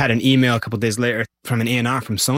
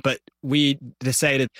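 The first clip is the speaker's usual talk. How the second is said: ordinary talk, nothing marked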